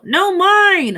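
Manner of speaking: high, loud holler back; high rival holler. Acted. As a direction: holler to claim it. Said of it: "hollered Bruce".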